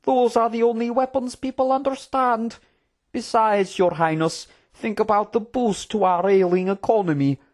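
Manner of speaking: animated, persuasive court politician voice; scheming royal advisor. Acted. Advisor urging His Highness to profit by war, persuasive. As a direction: argue the case smoothly to royalty.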